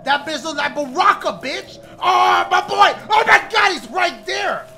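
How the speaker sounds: panicked high shouting; yelling in real fear, running; being chased. Real, not acted.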